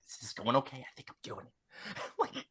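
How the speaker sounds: very soft, whispered aside; secretive whisper. Acted. Driver confiding in Tess that it's working, whispered. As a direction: whisper it privately, pleased with yourself.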